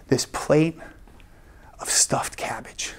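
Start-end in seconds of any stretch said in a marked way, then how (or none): 1.8-2.9 hushed whisper for the punchline. Acted. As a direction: whisper it like a sacred secret. Comic reveal of the plate.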